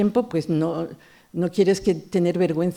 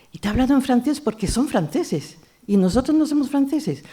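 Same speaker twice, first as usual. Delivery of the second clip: put-on higher mother's voice spelling it out to a child; the mother telling her daughter why, voiced higher; mother explaining; 8 semitones higher than usual. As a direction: explain it patiently to a little girl, pitched up.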